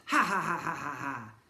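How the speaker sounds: loud mock villain ha ha; evil chuckle. Twins chuckling at their joke, evil laugh. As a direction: chuckle wickedly. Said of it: "The evil pair chuckled".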